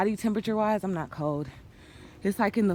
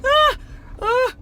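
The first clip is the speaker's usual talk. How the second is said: high startled yelp; startled scream; 8 dB louder than usual. Retold or imitated. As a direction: shriek in fright. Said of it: "scared me, like".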